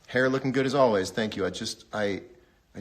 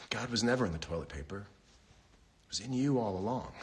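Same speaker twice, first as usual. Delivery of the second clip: normal talking voice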